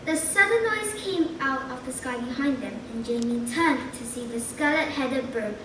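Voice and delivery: plain reading voice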